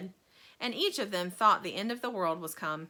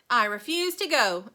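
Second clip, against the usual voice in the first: stubborn announcement; 3 semitones higher, 7 dB louder than usual. A narrator's reading. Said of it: "announced Grandpa George".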